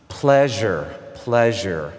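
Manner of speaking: careful, separate "Pleasure" with a voiced zh in the middle; pronunciation drill. Shown as a demonstration. Immigrant child practicing native-sounding words, pronunciation drill. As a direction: say "Pleasure" slowly, set apart, as a sound drill. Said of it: "practiced all the words".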